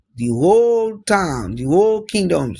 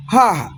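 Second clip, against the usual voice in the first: triumphant ha; 6 semitones higher, 4 dB louder than usual. A narrator's reading. Narrator exclaims at the defeat.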